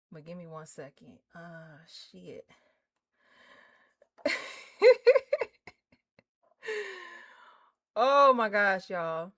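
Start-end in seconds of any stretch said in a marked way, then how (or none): 4.2-5.7 high laughing burst. Real, not acted. Streamer laughing while struggling to share the stream. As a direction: laugh at your own fumble.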